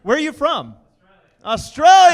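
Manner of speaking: loud excited call to the room. Real, not acted. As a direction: shout it out like an emcee. Host calls out to crowd.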